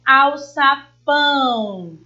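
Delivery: slow alçapão, syllable by syllable, PÃO stretched long; al-ça-PÃÃO, very slow with a long last syllable; stress on the last syllable. Shown as a demonstration. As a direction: draw out the final PÃO of alçapão, falling. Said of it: "porque a sílaba tônica é a última".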